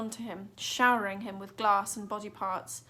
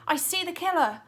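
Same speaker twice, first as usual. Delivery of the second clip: high loud call; girl calling from the alley; excited call; 8 semitones higher, 7 dB louder than usual. A narrator's reading. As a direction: call out the sighting. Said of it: "she called".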